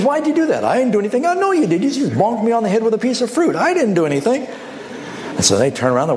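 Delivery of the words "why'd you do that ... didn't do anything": high, indignant back-and-forth accusations; squabbling voices pitched high; petty quarrel. Acted. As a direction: bicker like offended hikers. Quarrel in the monkey story.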